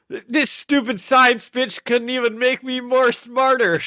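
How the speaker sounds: high-pitched, exasperated sitcom rant; It's Always Sunny character. Acted. Podcaster quoting a sitcom to co-host, high mock outrage. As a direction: whine loudly in indignant complaint. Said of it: "It's Always Sunny when they're like".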